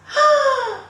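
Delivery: loud, high gasp of shock; big storytelling gasp; startled gasp. Acted. Fisherman shocked by talking fish.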